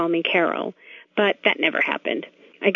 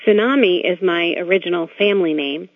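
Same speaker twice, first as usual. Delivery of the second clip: usual speaking voice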